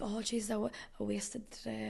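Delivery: soft, reenacted regret; regretful self-talk. Lying in bed at night, regretful self-talk. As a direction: voice dropped as if thinking aloud.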